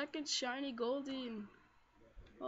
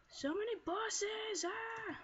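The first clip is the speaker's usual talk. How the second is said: high, drawn-out squeal of excitement; excited exclamation; 8 semitones higher, slower than usual. Real, not acted. Player finding bosses all around, excited exclamation. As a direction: stretch it out slowly, voice pitched right up.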